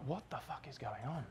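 hushed, dazed disbelief under the breath; stunned hush. Retold or imitated. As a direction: barely voice it, staring at a melting screen. Screen melting, stunned on a comedown.

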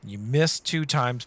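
ordinary talk, nothing marked

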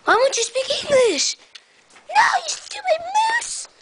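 high-pitched squealed scolding; play-acted tantrum, pitch leaping up; exasperated yelling. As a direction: scold it in a shrill, exaggerated squeal. Scolds a toy moose.

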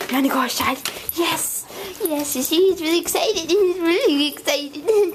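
high-pitched, giddy puppy-hyping voice; excited encouragement. Shown as a demonstration. Owner showing how to hype the dog up for a walk, excited. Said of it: "encouraging him like this".

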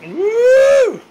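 whoop. Real, not acted. Cheering Joe's cooking.

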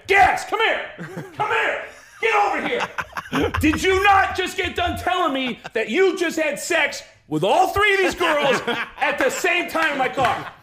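high-pitched, exasperated yelling; livid interrogation. Retold or imitated. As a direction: yell like an outraged friend demanding confirmation. Livid friend interrogating teller.

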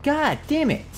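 high, loud, exasperated shout; frustrated outburst. Real, not acted. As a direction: blurt it out at full frustration. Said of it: "Oh man, I got frustrated".

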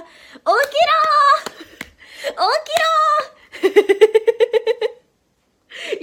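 high, long drawn-out yell to rouse sleepers; mock wake-up yell. Waking sleepy viewers with a frying-pan wake-up call, long yell. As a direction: stretch it out like banging a frying pan at dawn. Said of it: "フライパンで起こすやつ、しようか".

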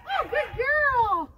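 slow gushing praise. Real, not acted. Trainer gushing over puppy in slow loud praise. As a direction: stretch it out, delighted.

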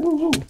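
high-pitched "woo" noises in a run; high whooping. Real, not acted. Player dodging fire in the game, high whooping. Imitated noise amid fireball attack.

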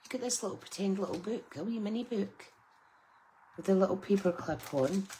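a light silly voice for the cute finds; funny voice. Pretend. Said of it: "I keep putting on a funny voice".

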